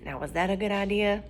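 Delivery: ordinary delivery, nothing marked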